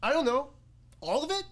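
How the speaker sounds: helpless reply. Retold or imitated. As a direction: voice climbing into a helpless question. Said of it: "And I go".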